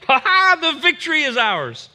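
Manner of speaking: high, loud gloating laugh into the cheer; mock triumph. Imagining Gideon cheering at the angel's news, high and triumphant. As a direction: crow it like the battle's already won. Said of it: "Would you not think".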